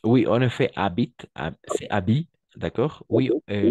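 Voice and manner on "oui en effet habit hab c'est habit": habit said "abi", H silent. Shown as a demonstration. Teacher corrects student's habit, H silent. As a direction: model habit with no H at all. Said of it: "le H est muet".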